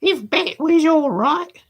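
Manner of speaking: put-on slow, high, bored boy; high Dodger voice. The Dodger teasing Tommy, high and slow. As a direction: drag it out, high and scornful.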